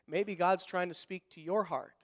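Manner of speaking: ordinary delivery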